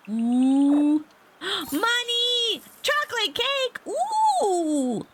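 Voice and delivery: very high, loud oohs and gasps; thrilled squealing. Real, not acted. Player opening the treasure chest, ecstatic. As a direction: shriek with delight at each prize.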